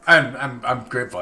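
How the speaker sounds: spooked and shaky. Real, not acted. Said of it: "I don't know why I sound scared".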